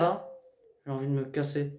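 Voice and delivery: ordinary delivery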